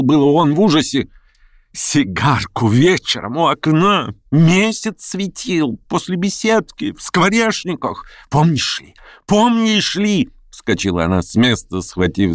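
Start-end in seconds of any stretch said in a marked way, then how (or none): 1.7-10.3 высокий, протяжный укор с нарастанием; припоминай обиду всё выше, допытываясь; яростное напоминание. A narrator's reading. Женщина яростно припоминает старую обиду. (яростно, с нарастающим надрывом)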